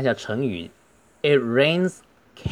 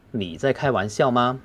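normal talking voice

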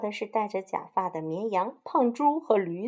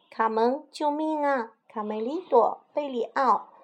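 high, urgent calls for help; desperate cry for help; 6 semitones higher than usual. Acted. Kidnapped animals crying to Carmen for help. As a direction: cry out to a friend as you are carried off. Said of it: "急得大喊".

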